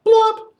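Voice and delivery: very high loud popping plop; sound-effect plop. Shown as a demonstration. Teacher leading the children in a loud high plop. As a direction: pop it like a stone in water. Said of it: "we're all going to say".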